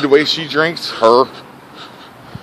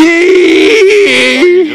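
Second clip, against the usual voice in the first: laughing groan; 11 dB louder than usual. Real, not acted. Reacting to crude joke.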